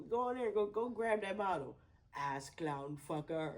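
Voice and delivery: loud, laughing mock Indian accent; hammed-up movie Indian accent, quoting for fun. Siblings quoting the movie's Indian guy in a loud mock accent. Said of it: "We'll just be like".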